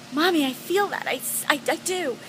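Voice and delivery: lightly voiced excited son; eager child. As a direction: blurt it out like a thrilled kid.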